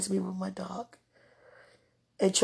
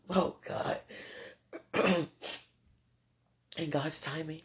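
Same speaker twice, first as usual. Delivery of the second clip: usual speaking voice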